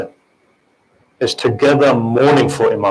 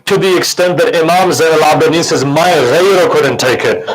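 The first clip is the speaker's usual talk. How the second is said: wailing majlis recitation; 5 semitones higher than usual. Acted. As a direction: rise into lament. Majlis climax recounting Sham.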